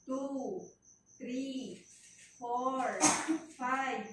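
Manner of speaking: firm, loud counting cadence. Real, not acted. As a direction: count her through the push. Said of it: "coach counting the push". (malakas na pagbibilang)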